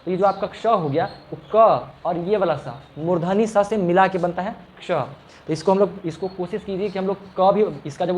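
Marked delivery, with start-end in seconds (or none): none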